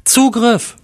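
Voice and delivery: high-pitched cry into a radio; shouted command. A narrator's reading. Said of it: "schrie Jack ins Funkgerät".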